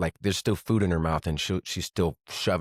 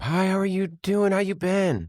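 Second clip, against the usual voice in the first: put-on high, sing-song friendly old lady; much higher mock mom greeting; imitating mom; 12 semitones higher than usual. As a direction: chirp it like his mother greeting everyone. Mom greeting strangers in hospital.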